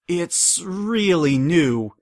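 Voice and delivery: slow, drawn-out nervous excuse. A narrator's reading. Mayor caught out by Twilight, nervous and slow. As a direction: stall uneasily.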